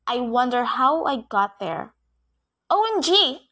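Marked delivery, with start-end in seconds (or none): 2.7-3.5 shouted. A narrator's reading. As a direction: burst out in shock. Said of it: "I shouted".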